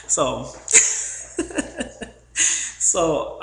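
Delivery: high breathy giggles; real laughter; burst of laughter. Real, not acted. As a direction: break up laughing. Laughing again mid-story.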